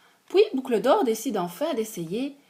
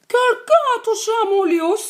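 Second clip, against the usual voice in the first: higher, louder, quick mama bear; 12 semitones higher, 7 dB louder, faster than usual. Acted. Mama bear also finding her bed touched, high and quick. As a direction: pipe it up like an indignant mama bear. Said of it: "Maman ours également".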